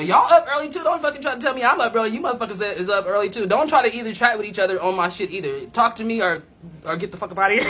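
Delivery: loud pretend rant breaking into a laugh at the end; mock scolding. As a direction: jokingly tell off the whole audience at full volume. Mock scolding the live viewers.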